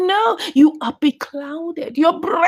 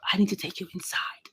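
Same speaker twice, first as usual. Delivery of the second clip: whisper; 8 semitones lower, 12 dB quieter, faster than usual. Retold or imitated. Husband coaxing daughter in car.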